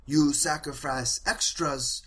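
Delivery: a lightly voiced village elder explaining sacrifice to the star; confused village elder. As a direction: lean in with puzzled awe. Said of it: "She's like".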